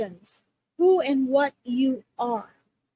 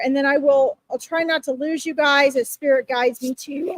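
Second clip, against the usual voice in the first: normal talking voice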